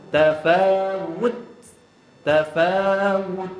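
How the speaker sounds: slow Tafawud, d held then released; held final d. Shown as a demonstration. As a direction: stretch Tafawud and hold its last d. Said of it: "Dia kena tahan dulu".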